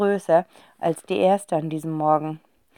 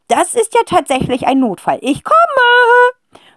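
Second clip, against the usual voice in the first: high, loud decisive call; calling aunt; 7 dB louder than usual. A narrator's reading. Aunt calling that she is coming, triumphant. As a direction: announce it triumphantly. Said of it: "ruft sie".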